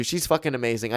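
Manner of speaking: ordinary delivery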